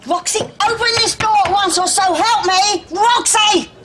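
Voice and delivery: high, loud bellow through a door; furious threatening shout. Acted. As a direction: escalate to an outraged yell. Escalating demand through locked door.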